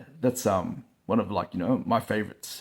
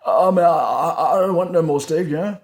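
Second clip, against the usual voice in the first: put-on drunk friend pleading; 6 semitones higher, 6 dB louder than usual. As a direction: whimper like a beaten drinker begging off. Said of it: "Next minute".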